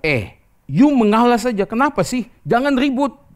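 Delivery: high, loud exasperated nagging; scolding wife. Retold or imitated. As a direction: imitate the wife telling off her husband loudly. Wife scolds husband in retold quote.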